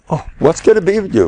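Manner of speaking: high, fast exasperated outcry; mock scolding scream. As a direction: scold like a father at his wits' end. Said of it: "he screams at his kids".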